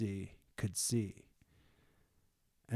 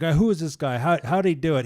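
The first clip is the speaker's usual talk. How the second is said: loud, higher mock questioning; pressing interrogation; 6 semitones higher, 11 dB louder, much faster than usual. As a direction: act out pestering questioners, raised voice. Retold repeated interrogation of healed man.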